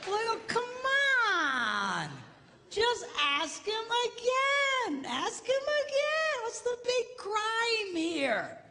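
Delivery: high, drawn-out exasperation; exasperated plea. Real, not acted. Preacher pleads with the wives, exasperated. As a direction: plead with mock disbelief.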